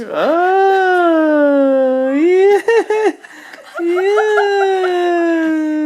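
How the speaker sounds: loud, high delighted cheer; excited shout. Real, not acted. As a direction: burst out with joy and laughter. Baby finally smiles on camera.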